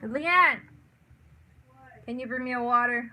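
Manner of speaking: choking throat, hurried ask. Real, not acted. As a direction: talk through a choking throat. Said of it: "I'm choking".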